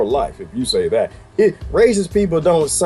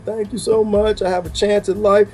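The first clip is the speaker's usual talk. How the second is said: imitating grateful inmate; 6 semitones higher than usual. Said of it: "she's, like, crying".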